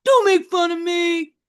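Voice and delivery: put-on high, loud teen outburst; angry shout. James shouting at Mom for laughing at him, loud. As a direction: yell it like a stung teenager. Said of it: "James shouted".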